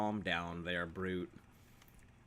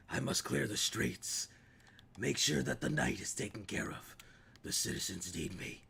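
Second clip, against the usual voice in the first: Batman voice; 3 dB quieter than usual. Acted. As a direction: brood like a caped vigilante. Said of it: "Why are you playing Batman?".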